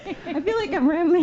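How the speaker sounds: nervous, high and loud; flustered and anxious; stage fright. Real, not acted. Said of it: "I'm a little nervous".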